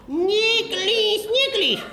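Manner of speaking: mock high-pitched flirting; falsetto come-on. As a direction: sing it out high like a lovesick fool.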